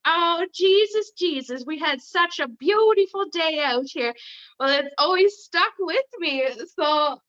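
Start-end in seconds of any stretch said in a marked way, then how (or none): 0.1-4.1 put-on Newfoundland old ladies. Guest recalls TV show's old ladies, imitated Newfoundland accent. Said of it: "those old ladies were always like".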